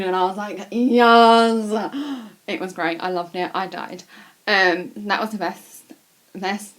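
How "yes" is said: loud, drawn-out fangirl yes; exaggerated triumphant shout; re-enacted excited cheer. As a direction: belt it out in delight, stretched long. Excited reply to idol retold.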